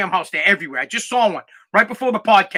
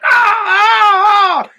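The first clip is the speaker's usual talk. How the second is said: high shrieking scream, imitating a frightened mom; mock panicked squeal at the bat; mom's shriek; 12 semitones higher, 6 dB louder, much slower than usual. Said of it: "my mom is like".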